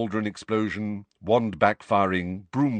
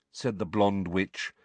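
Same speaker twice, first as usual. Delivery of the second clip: usual speaking voice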